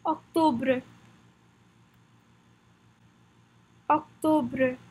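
Octobre said "ok-to-bruh", French r; French pronunciation. Shown as a demonstration. Teacher leads repetition of the month, Octobre in French pronunciation. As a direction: model Octobre evenly with a French "-bre" ending. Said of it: "Practice the pronunciation with me".

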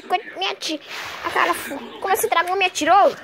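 ordinary delivery

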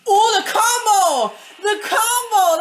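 high, loud, slow and laughing with joy; thrilled laughing. Real, not acted. Player cheering own combo, high laughing excitement. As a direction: laugh out the delight at a perfect combo.

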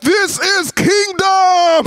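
high, drawn-out mock war cry; mock battle cry. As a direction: stretch it out like an epic movie shout. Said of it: "he'll just go".

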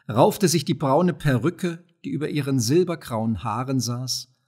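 ordinary delivery

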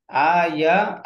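"আয়া" with a stretched opening aa; long first vowel. Shown as a demonstration. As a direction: hold the first vowel of "আয়া", keep the ya short. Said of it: "আমি বলি খেয়াল করেন".

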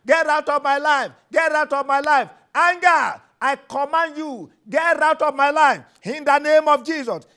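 loud commanding preacher, hammering each get out; a preacher shouting commands at a spirit; fervent deliverance prayer. Acted. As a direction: cast the anger out with full force. Rebuking anger in prayer.

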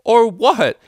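high, loud defiance with fear showing; cracking, fearful shout. A narrator's reading. Frightened colt still defying the mare, cracking shout. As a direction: shout back with your voice cracking. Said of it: "he shouted, voice cracking, betraying his fear".

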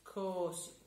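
plain reading voice

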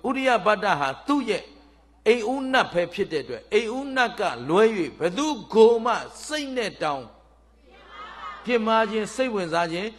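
loud, high declaration of a loyal princess; firm rebuff. Acted. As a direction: turn the suitor away emphatically. Princess rebuffs the wooing deity.

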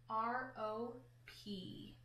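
very slow, soft letter by letter; slow sounding out. Shown as a demonstration. Teacher slowly spells rope for the class. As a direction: drag out each letter R, O, P. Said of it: "what letter makes each sound?".

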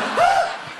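very high, loud mock shriek; short yelp of panic; startled scream. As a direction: shriek as if caught out. Acts out his startled scream.

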